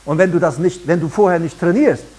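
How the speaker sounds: ordinary delivery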